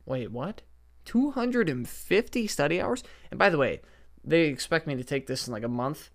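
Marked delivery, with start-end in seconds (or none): 0.1-3.0 high incredulous rise; disbelief. Retold or imitated. Reacting to the study hours with mock disbelief. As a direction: sound shocked at the number. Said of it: "And I was like".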